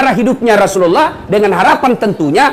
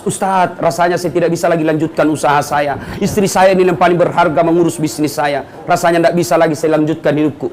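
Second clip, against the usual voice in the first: ordinary talk, nothing marked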